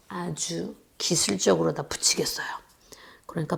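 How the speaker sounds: soft unvoiced whisper while working delicately. Real, not acted. Teacher gluing carefully for children, whispered. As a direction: hush as if concentrating.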